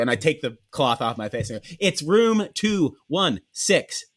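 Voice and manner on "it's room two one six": each word slow and spelled out; slow, deliberate repeat. Retold or imitated. Guest repeating room number to the clerk, slow and deliberate. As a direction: say it slowly as if he's dense. Takes cloth off, repeats slowly.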